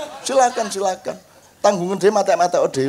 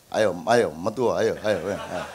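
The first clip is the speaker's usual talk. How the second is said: low coaxing to ghosts; 11 semitones lower, 4 dB quieter than usual. Retold or imitated.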